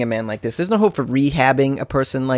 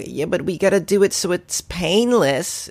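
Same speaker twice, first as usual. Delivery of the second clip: slow, higher mocking voice of squeamish officials; 5 semitones higher than usual. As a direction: drag it out like a prissy official. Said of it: "and then they're like".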